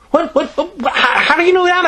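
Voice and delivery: very high, flustered stammer. A narrator's reading. Boy alarmed by Greta's news, high stammer. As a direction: trip over the words in alarm. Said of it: "I stammered".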